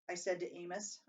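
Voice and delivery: usual speaking voice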